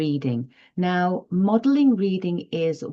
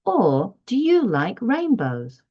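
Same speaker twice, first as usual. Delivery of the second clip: "Or do you like rainbows" asked high and lively; question tune; 4 semitones higher than usual. Shown as a demonstration. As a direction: ask it as a bright question for the child. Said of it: "the shift in our inflection".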